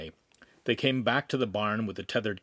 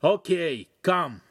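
high, louder call down; calling from above; 6 semitones higher, 5 dB louder, slower than usual. A narrator's reading. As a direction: call down from the loft. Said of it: "he called from the top".

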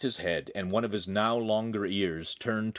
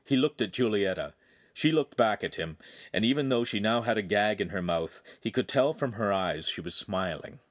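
ordinary delivery

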